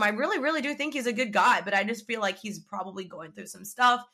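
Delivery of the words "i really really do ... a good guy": ordinary talk, nothing marked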